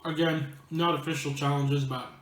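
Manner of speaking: talking through a mouthful of chips; chewing while speaking; messy loud eating. Real, not acted. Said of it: "I'm gonna be talking with my mouth full".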